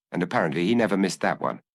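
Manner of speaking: ordinary delivery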